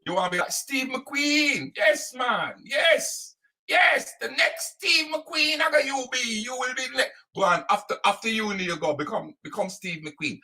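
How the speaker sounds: lightly voiced doting father, gushing; mock a proud parent hyping up his boy; proud dad to his son. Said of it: "you was mostly talking to your youth and going".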